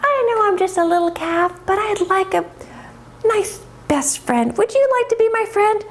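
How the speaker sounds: put-on high-pitched, childlike calf voice; a small calf asking for a friend; little calf. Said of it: "And Bella says".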